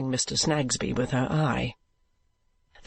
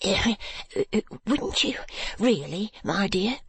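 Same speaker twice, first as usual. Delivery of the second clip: meek, higher stammering question; a hint of a meek stationer; meek husband; 6 semitones higher than usual. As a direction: tiptoe around his wife's temper. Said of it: "with his cough of meekness".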